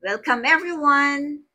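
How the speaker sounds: teacher demoing a warm, loud welcome to passengers; cheerful welcome. Shown as a demonstration. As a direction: sing out a big welcome to arriving guests. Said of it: "You can say".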